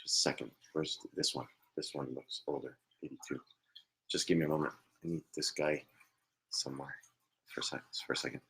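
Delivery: soft, low-pitched murmur; muttering to himself. Real, not acted. Medium searching for the right spirit, muttered low. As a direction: think aloud quietly, half to yourself.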